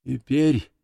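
mild, meek plaintiveness, no real whisper. A narrator's reading. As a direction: let the preacher's fervour drop into meekness. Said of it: "голос снова понизился до обычного жалобного шёпота". (жалобно, кротко, без пафоса)

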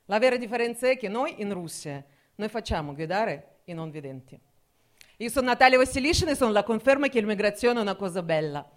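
ordinary delivery

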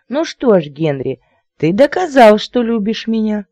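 high, squeally coquette; thin squeally lady. A narrator's reading. Lady to suitor after the kisses, squeally. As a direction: pipe it up coyly, high and thin. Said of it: "тонкий визгливый голосок".

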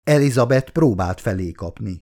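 plain reading voice

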